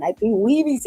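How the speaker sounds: ordinary talk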